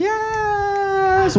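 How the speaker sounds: high excited crowd yes. Retold or imitated. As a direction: cheer it like the whole crowd. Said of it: "Everybody's like".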